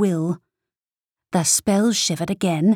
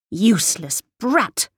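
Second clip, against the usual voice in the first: a narrated mother spitting insults; mother's cruel snarl; 3 semitones higher than usual. Acted. Mother berating her daughter, contemptuous. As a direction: scorn the child.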